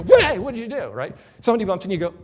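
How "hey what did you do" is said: higher and much louder, a hammed-up indignant outburst; mock angry retort. Imitating a person bumped in the street, mock angry outburst. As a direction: snap back like someone just bumped you. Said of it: "We don't have to go".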